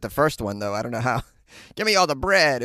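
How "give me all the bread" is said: put-on higher old-movie gangster voice; mock vintage mobster; old-timey robber. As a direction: demand it like an old-time crook. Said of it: "It was another old person".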